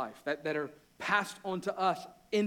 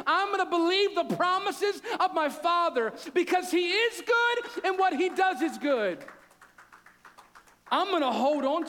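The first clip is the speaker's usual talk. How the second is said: much higher and louder, fervent and driving; preaching at full force; 9 semitones higher, 6 dB louder than usual. Acted. Pastor urging the congregation to hold on, fervent loud preaching. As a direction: preach it with fire, declaring each promise.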